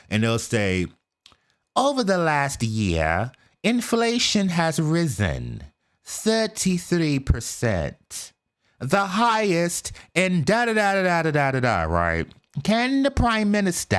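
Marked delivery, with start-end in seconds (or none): 1.8-11.8 slow, lightly put-on British parliament voice; mock British MP. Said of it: "when they're doing their prime minister's questions". 12.6-13.9 high, lilting British parliament question; mock British MP. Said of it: "the way with their different accents".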